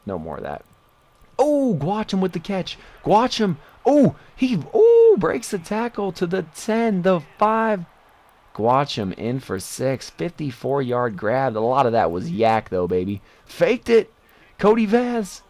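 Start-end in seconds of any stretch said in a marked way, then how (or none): 1.4-7.8 high, loud excited yelling of the run; rising thrill as the runner breaks free; excited play call. Real, not acted. Touchdown run call in game. 13.4-15.4 excited. Real, not acted. Reacting to play in game.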